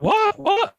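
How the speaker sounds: very high, puzzled what; high mock confusion; mates confused. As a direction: play the baffled mates. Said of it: "they're like".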